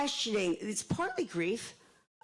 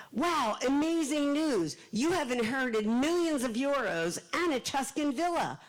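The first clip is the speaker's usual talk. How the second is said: excited announcement; 6 semitones higher, 3 dB louder than usual. Acted. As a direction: announce a huge windfall, playing it up. Reads out surprise inheritance letter.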